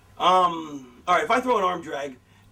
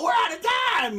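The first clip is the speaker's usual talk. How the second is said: much higher, urgent announcer call; announcer signing off. Retold or imitated. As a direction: cut the broadcast off in a panic. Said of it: "and they go".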